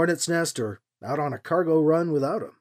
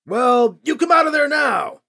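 higher, louder yelled order; yelling; 10 semitones higher, 7 dB louder than usual. A narrator's reading. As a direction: yell the command up the hill. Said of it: "the man yelled".

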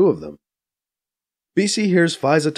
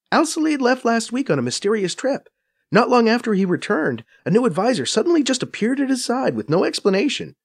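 higher, brisk Faiza voice, a bit scornful; Faiza explaining; 6 semitones higher than usual. A narrator's reading. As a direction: narrator playing Faiza, quick and knowing. Faiza gossiping.

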